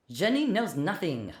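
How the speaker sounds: soft, soothing reassurance; consoling. A narrator's reading. Francois consoling Philip, softly. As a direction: hush him gently. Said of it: "Francois consoled him".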